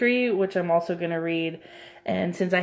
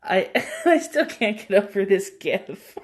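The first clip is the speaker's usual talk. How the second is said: talking through real laughter; words broken up by laughter; laughing; 2 semitones higher, 3 dB louder than usual. Real, not acted. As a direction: giggle helplessly while getting the words out. Cracking up at the gif.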